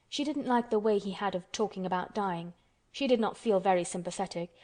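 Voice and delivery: usual speaking voice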